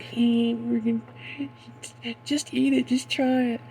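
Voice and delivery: high mock kid voice, giggly; mocking a silly kid offering pudding; giggling dumb kid. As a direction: sell the mud pudding like a sneaky kid.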